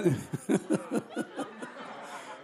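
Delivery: real high, soft laugh breaking off the sentence; helpless laugh. Real, not acted. Speaker cracking up before the head table, helpless laughter. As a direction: crack up mid-word.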